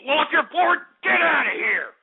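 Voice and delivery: high, indignant yell; angry dismissal. Real, not acted. Host telling bored listeners to leave, angry.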